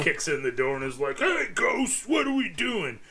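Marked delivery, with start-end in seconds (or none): 1.2-3.0 loud, high bro shout; frat boy voice. Retold or imitated. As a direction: yell it like a cocky frat boy. Imitating frat boy host kicking door.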